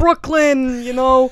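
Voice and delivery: loud mock Brooklyn accent; proud Brooklyn Italian. Mocking their Italian complex, loud Brooklyn accent. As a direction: throw it out as a boastful Brooklyn Italian. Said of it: "they have this complex about being Italian".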